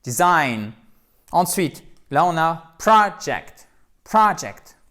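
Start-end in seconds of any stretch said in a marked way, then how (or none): 0.0-0.7 "Design" stressed loudly on -SIGN; English "Design", light start, strong ending; second-syllable stress. Shown as a demonstration. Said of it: "l'accent tonique est sur la seconde syllabe". 2.8-4.8 "project" punched loudly on PRO; English "project", high strong first syllable; first-syllable stress. Shown as a demonstration. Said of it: "c'est la place de l'accent tonique".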